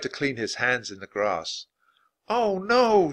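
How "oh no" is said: high cry of dismay; high-pitched panic; alarmed shout. A narrator's reading. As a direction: call out in sudden alarm. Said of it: "shouted Annie".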